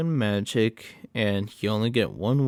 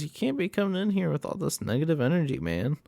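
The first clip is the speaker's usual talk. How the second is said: mock hippie clerk; 6 semitones higher, faster than usual. Clerk voiced lecturing Bear.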